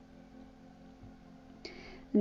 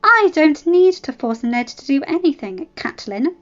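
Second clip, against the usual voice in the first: loud, higher angry retort; furious; 6 semitones higher, 7 dB louder than usual. Acted. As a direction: bite back in fury. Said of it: "Ella said, furious".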